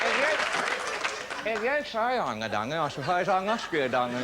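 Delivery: sheepish mock apology laced with laughter; mock meek. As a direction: play the innocent while taking the mickey. Driver answering cop with mock meekness.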